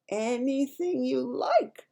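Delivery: slow, proud giant's boast; proudly. A narrator's reading. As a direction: savour the boast. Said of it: "the B F G said proudly".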